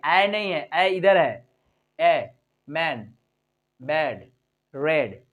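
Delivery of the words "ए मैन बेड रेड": "ए, मैन, बेड, रेड" low and slow with an even ए; low, measured "ए, मैन, बेड, रेड"; simple ए vowel. Shown as a demonstration. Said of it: "simple बोले".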